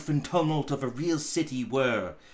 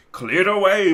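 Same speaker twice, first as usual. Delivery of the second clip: much louder, booming command; hearty loud order; 3 semitones higher, 10 dB louder than usual. A narrator's reading. Fezziwig telling his lads to clear the floor, booming order. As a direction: bellow it cheerfully to the lads.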